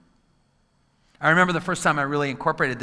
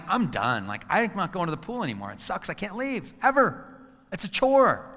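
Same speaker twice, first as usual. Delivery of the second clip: 1 fed-up rant; 6 semitones higher than usual. Retold or imitated. Parent fed up with kids at pool, rising exasperation. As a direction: vent like a worn-out parent.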